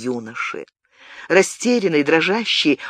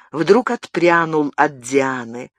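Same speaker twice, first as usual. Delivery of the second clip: ordinary delivery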